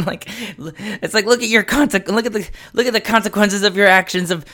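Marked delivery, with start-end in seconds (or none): 1.2-4.4 high, exasperated lecture breaking into laughter; mock scolding. Man retelling lecturing his cat, mock angry. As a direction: scold a pet, cracking up at the end.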